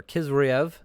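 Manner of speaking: "Kizriev" starting on a hard K; K pronunciation. Shown as a demonstration. Host offering the other name, "Kizriev" with a K. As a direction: say "Kizriev" with a K in place of H. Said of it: "both with the K".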